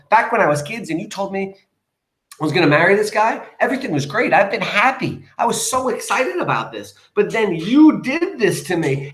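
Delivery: high, melodramatic reproach; aggrieved daughter. Acted. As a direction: plead your case to mother, hammed-up. Acted daughter reproaching her mother.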